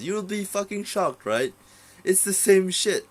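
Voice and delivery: high, loud burst of disbelief; excited exclaim. Real, not acted. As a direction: throw your voice up in shocked disbelief. Excited point about the tiger.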